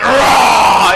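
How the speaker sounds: loud mock monster roar. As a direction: roar at her like a playful monster. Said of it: "and I went".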